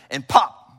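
sharp loud pop for the joint snapping in; pop sound. Retold or imitated. Pastor telling the congregation how the shoulder went in, sharp loud pop. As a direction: punch out the pop.

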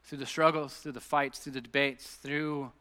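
hushed, unhurried congregational prayer; leading prayer. Real, not acted. Leads closing prayer.

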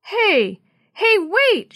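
high, loud hailing call; shouting. A narrator's reading. Jenny running after the truck, shouting. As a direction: shout after someone driving off. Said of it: "she shouted".

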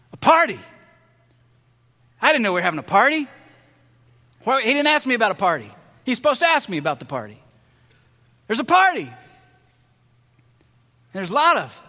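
shocked, then fast and put out; older brother sputtering about a party he wasn't told of; indignant surprise. Acted. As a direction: blurt it out, offended nobody asked him. Older son hears a surprise party.

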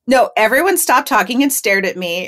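plain reading voice

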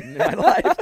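loud quote broken by laughter; laughing. Retold or imitated. Retelling Andrew Doran's standing ovation joke, laughing through it. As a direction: crack up while quoting the speaker.

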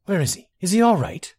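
fearful for her friend; anxious. Acted. Girl anxiously asking the general about her friend, worried. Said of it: "she asked in genuine trepidation".

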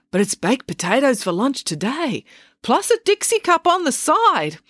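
put-on high old man, rising on the treat; elderly doctor. Scene: doctor tempting them to stay for lunch, high old voice.

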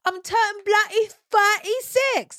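high, loud mock British boast; birthday brag in a hammed-up British accent. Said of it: "with my accent".

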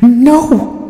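high, loud, frantic scream. Acted. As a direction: scream it out in panic. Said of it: "she screamed at almost full volume".

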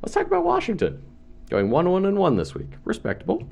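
words pushed out on a burp. Real, not acted. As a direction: talk through a sudden burp. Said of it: "that burp came out of nowhere".

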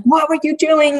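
high, loud shocked question; the mom snaps it out in alarm; shocked scolding. Retold or imitated. Mother picking up her missing daughter, shocked and loud.

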